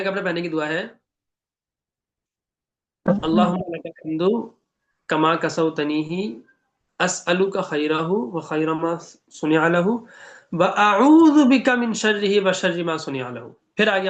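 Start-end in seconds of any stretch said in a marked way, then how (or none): 3.1-13.5 slow, measured Arabic recitation; dua recitation. Shown as a demonstration. Teacher reciting the new-clothes dua to students, slow recitation. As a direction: recite the supplication slowly, drawing out the syllables.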